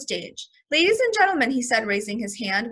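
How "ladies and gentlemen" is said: high, loud announcer call; announcing. A narrator's reading. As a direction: hail the crowd like a showman. Manager announcing to the audience.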